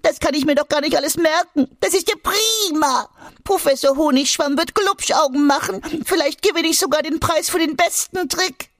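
very high, squeaky, thrilled creature voice; overjoyed cry. A narrator's reading. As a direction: burst out in delight, tumbling over the words. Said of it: "da schrie Egon beglückt".

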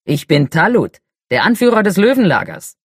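loud, proud booming chieftain; fire giant voice. Acted. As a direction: announce yourself like a giant chief. Talut introduces himself proudly.

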